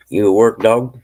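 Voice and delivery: loud call across the room. Real, not acted. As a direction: call out to a friend at a distance. Calling out to someone nearby.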